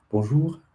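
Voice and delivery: Bonjour with a rising finish; French demo. Shown as a demonstration. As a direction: try Bonjour with a lift at the end. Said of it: "Sounds a bit more Quebecois".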